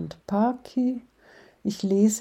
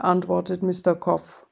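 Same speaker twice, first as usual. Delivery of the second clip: ordinary delivery, nothing marked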